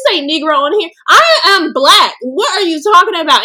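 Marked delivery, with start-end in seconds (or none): 1.1-3.4 high, loud, incredulous insistence; indignant outburst. Real, not acted. Answering critics who say she talks about race too much, indignant. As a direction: hammer it out in real exasperation.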